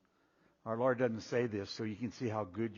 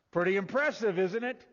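mock impressed; 8 semitones higher, 9 dB louder than usual. Preacher mocks Peter's generosity.

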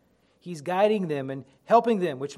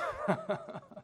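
light chuckle; 5 semitones higher, 6 dB quieter than usual. Real, not acted. Laughs at own slip.

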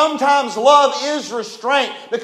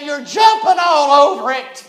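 fed-up mother; 4 semitones higher, 3 dB louder than usual. Retold or imitated. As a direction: drag it out like a worn-out mom. Mother scolding rowdy kids.

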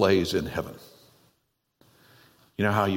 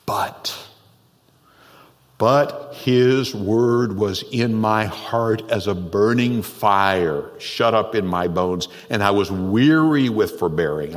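fervent scripture reading; 3 dB louder, slower than usual. Acted. Preacher reads Jeremiah's fervent verse.

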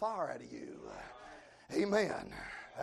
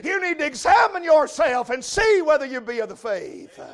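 raised, driving pulpit delivery; full-force preaching; 7 semitones higher, 11 dB louder than usual. Real, not acted. Preacher challenging the backslidden to examine themselves, full-force preaching. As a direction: press the challenge home in strong bursts.

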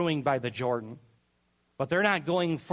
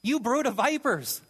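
raised, high denouncing cry; John's rebuke; 7 semitones higher than usual. Retold or imitated. Preacher voicing John the Baptist denouncing the Pharisees, high and scornful. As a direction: hurl it at them like a prophet. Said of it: "He calls them what?".

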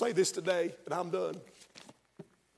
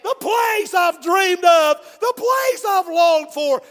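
much higher and louder, slow ecstatic hollering; joyful shout arriving in heaven; 9 semitones higher, 16 dB louder, slower than usual. Retold or imitated. Dying man arriving in heaven, ecstatic shout. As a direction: holler it like you just crossed into glory. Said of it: "and said".

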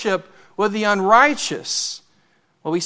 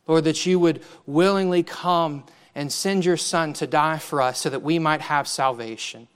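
softer, reverent prayer voice; pastoral prayer; 3 semitones lower, 3 dB quieter than usual. Acted. Pastor praying aloud with the congregation, softer and reverent. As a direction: pray it humbly before God.

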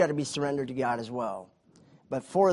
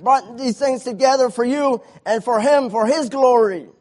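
louder, higher fervent exhortation; preaching at full force; 9 semitones higher, 10 dB louder than usual. Acted. Preacher urging fathers with loud fervour. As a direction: hammer it home to the young men.

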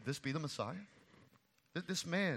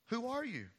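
higher, eager questioning crowd; 7 semitones higher than usual. Retold or imitated. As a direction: press him with questions. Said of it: "they asked".

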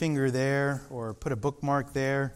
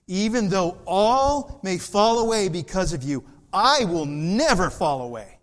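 Peter's boast; 5 semitones higher, 5 dB louder than usual. Acted. Preacher voicing Peter's boast.

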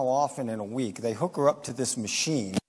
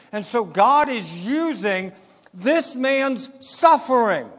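raised, forceful preacher's voice; emphatic preaching; 7 semitones higher, 5 dB louder, slower than usual. Real, not acted. Preacher telling the congregation why God allows suffering, forceful. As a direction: preach it high and slow, hitting key words.

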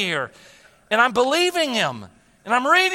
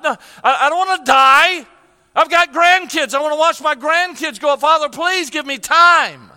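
rising, louder and higher, begging God not to let him die; tearful pleading prayer; 6 semitones higher, 7 dB louder than usual. Retold or imitated. Alone at night after surgery, pleading with God, loud tearful prayer. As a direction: cry out to God, voice climbing, slowing at the end. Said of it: "I cried. I wept. I said".

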